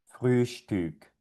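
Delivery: "Frühstück" with two rounded ü; German ü. Shown as a demonstration. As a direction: model "Frühstück" clearly. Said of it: "Y esta palabra sonaría".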